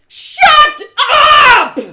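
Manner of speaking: very high, very loud cracking scream; furious scream. Acted. Trinia screaming at her tormentor, furious. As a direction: scream it out in raw rage.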